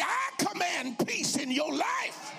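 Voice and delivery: high shouted preacher's decree; commanding with authority. Shown as a demonstration. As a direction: command it as if binding demons. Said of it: "Say it like you're binding demons and devils".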